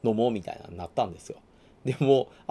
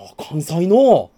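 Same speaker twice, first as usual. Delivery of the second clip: impressed; 5 semitones higher, 12 dB louder, slower than usual. Retold or imitated. Local's drawn-out impressed reply.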